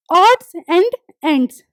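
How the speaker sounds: very slow, separated ODDS AND ENDS; demonstrating the sound. Shown as a demonstration. Teacher has students listen to the sound, slow demonstration. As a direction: say "odds and ends" word by word, with gaps. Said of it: "इसकी sound सुनो".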